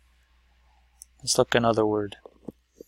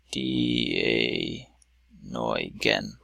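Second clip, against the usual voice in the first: low, drawn-out syllables with gaps between; 12 semitones lower, 5 dB quieter, much slower than usual. Shown as a demonstration. Teacher splitting a Greek word for students, syllable by syllable. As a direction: break the word slowly into its parts. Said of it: "Those are your syllables".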